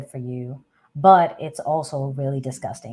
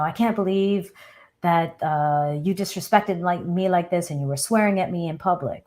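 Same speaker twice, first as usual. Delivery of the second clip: normal talking voice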